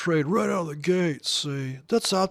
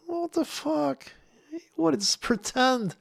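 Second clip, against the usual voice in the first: much higher, slow disbelief; incredulous; 12 semitones higher, slower than usual. Real, not acted. Ranting at secretive traders, slow high outrage. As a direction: stretch it out in stunned disbelief.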